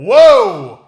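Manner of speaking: very loud, high, drawn-out whoa; booming re-enacted interruption; retold halting shout. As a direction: bellow to stop everyone.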